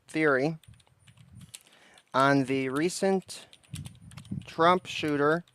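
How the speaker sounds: halting, drawn-out words with long gaps; dictating while typing. Real, not acted. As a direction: dictate it slowly, piece by piece.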